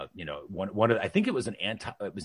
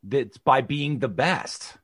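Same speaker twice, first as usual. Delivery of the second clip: slow, higher, louder mock explanation; sarcastic; 6 semitones higher, 5 dB louder, much slower than usual. Son answering mom's demand to know how, sarcastic. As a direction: spell out the empty answer as if it settles everything. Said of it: "I'm being quite sarcastic there".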